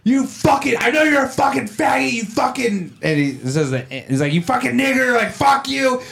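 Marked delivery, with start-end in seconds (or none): 0.0-3.0 high-pitched mock rant; imitated angry yelling. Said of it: "He's yelling". 4.3-6.0 high, frenzied mock screaming; imitated insane yelling. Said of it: "he's going insane".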